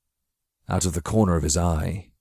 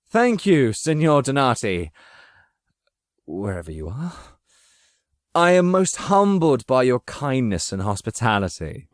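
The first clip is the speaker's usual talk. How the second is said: high, overdone mock thanks; feigned gratitude; 7 semitones higher than usual. Machiavelli thanking his unseen host, hammed-up false gratitude. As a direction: pour on fake gratitude.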